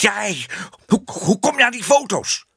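high, stammering angry demand; furious. A narrator's reading. Exposed man questions the projector boy, furious and high. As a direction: demand it furiously, tripping on the first words. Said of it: "zei Ellemeet woedend".

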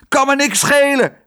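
high, loud furious retort; angry shout. A narrator's reading. The cook shouts angrily at his daughter. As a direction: bark it back in rage. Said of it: "riep Tos".

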